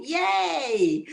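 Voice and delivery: bright little cheer, pitch jumps up. Real, not acted. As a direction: cheer for Liverpool. Cheering for Liverpool.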